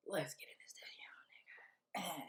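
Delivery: conspiratorial whisper. Real, not acted. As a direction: whisper it like a secret scheme. Secret plan whispered to audience.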